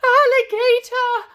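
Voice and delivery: high, loud alarmed shriek of a word; scream. A narrator's reading. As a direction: shriek it like you saw a monster. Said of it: "mum screamed".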